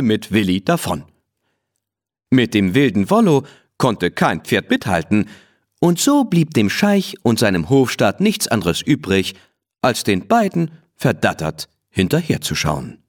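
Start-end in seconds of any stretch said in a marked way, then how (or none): none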